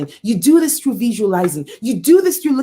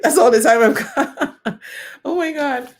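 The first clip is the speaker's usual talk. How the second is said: laughing. Real, not acted. Speaker laughs at time's up.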